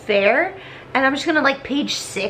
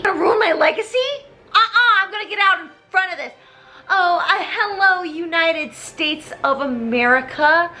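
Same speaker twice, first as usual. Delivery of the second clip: high, fast panicky voice; high frantic voice; 7 semitones higher than usual. Retold or imitated. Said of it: "He's just like".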